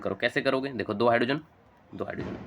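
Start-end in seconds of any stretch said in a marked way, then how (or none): 1.9-2.4 whispered, fast count under the breath; hushed quick whisper while counting. Real, not acted. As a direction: tick it off in a whisper to yourself. Teacher counting atoms under breath.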